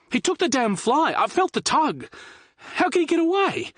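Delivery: high, loud frantic protest; outraged shout. Acted. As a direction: cry out in disbelief. Liam outraged fish escaped.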